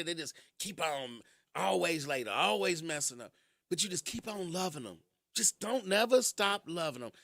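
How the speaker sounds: usual speaking voice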